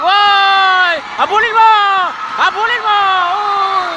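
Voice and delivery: very high, loud urging yell; frantic shout to the player; excited shout. Real, not acted. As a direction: scream at her to chase it. Urging player to chase ball.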